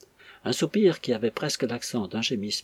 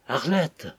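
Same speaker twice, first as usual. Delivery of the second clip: trembling command; 6 semitones higher than usual. A narrator's reading. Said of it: "la voix tremblante sur un ton de commandement".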